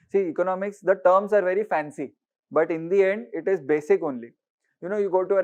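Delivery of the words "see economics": ordinary delivery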